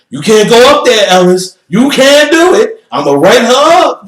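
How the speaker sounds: very high, loud frantic yell; shrill yelling lady. Retold or imitated. Desk lady yelling at Ellis, a very high, loud frantic yell. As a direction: shriek the warning at him.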